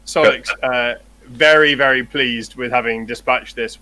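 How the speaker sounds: ordinary talk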